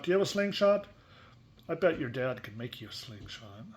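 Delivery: usual speaking voice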